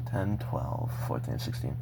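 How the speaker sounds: soft, whispered count trailing off; tally them quietly to yourself; counting under breath. Real, not acted. Counting her cassette tapes to herself, in a soft whisper.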